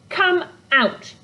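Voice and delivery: high, firm order; emphatic. A narrator's reading. Girl ordering the creature out of the tent, firm and high. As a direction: put your foot down on it. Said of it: "stamping her foot for emphasis".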